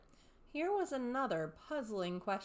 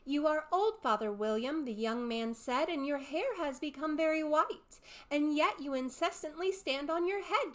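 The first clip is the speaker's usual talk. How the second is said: higher, louder sing-song recital; poem recitation; 6 semitones higher, 4 dB louder than usual. A narrator's reading. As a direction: recite the verses like a schoolgirl.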